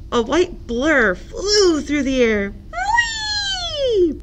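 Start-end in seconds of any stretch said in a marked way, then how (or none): 2.7-4.1 very high drawn-out whee; gleeful whee. Acted. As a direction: squeal with flying joy. Duck flying through air.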